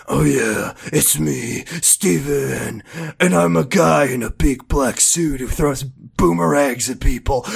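low, hushed spoof of a superhero voice; mock character voice. Co-host parodying the other's bad voice acting, mock low character voice. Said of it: "if I was doing a voice and I was like".

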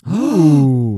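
high, loud, drawn-out swoon; hammed-up awe. Co-hosts reacting to Bagel World, in hammed-up awe. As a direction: gush slowly, over the top.

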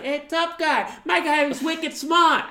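loud, high Boston tough guy; Boston accent. Acted. Imitating Boston guys from a movie, thick Boston accent. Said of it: "doing the Boston accent earlier".

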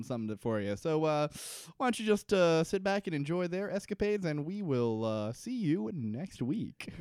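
cheesy host to the audience at home; hammed-up TV host outro. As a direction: play the corny show host signing off. Parody host sign-off.

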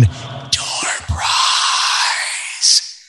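breathy stage whisper, voiceless; sneaky whisper. Shown as a demonstration. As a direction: hush it like a secret reveal. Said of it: "You should have gone".

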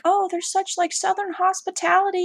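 high-pitched mock gushing; mocking quote. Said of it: "they're like".

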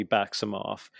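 ordinary talk

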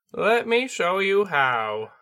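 high, slow bird spirit teacher; a patient bird sensei, slowly; Tengu voice. Acted.

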